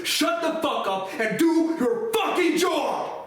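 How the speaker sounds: screamed high and loud, slow; furious shout. Retold or imitated. To the tax collector on the phone, furious shout. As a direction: roar it at him, fed up.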